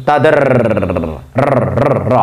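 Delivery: তাদের with a long drawn-out র at the end; slow teacher demo of the র sound; stretched r sound. Shown as a demonstration. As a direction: stretch the র slowly, then repeat র, র. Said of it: "দেখো র উচ্চারণ হচ্ছে কিন্তু".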